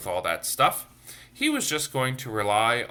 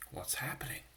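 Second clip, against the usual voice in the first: breathy whispered question; whispered aside; 11 dB quieter than usual. Real, not acted. Asking the children in a whisper. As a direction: whisper it like a secret.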